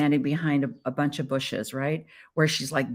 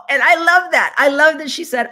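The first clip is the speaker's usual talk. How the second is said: much higher and louder, gushing; delighted; 10 semitones higher, 9 dB louder than usual. Real, not acted. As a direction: burst out with real joy. Delighted by stranger's compliment.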